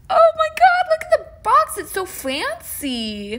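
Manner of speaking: very high, loud squeal; thrilled. Real, not acted. Collector seeing the fancy box, high loud squeal. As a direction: shriek with delight at the box.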